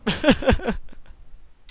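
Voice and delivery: high breathless evil laugh; wicked monster laugh; evil cackle. Acted.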